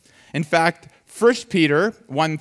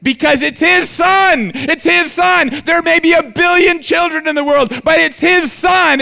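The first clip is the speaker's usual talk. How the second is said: preaching at full force; 13 semitones higher, 9 dB louder than usual. Acted.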